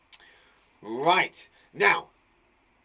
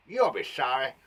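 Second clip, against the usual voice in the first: snarled threat. Acted. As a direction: spit it out as a warning. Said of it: "nice thing to snarl".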